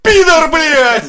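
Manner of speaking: loud, high, slow cry of outrage; outraged yell. Real, not acted. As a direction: yell it out in disbelief.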